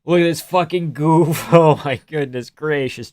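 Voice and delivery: ordinary talk, nothing marked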